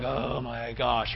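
low, slow, hushed "oh no" dread; dismayed groan. Acted. Reluctant runner as the whistle nears, dismayed. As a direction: draw it out low and soft, dismayed.

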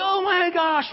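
high, loud, thrilled gasp of words; joy-filled amazement. Retold or imitated. Preacher reliving a healing in his dream, ecstatic. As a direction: exclaim it, overjoyed and astonished. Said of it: "joy-filled and happy".

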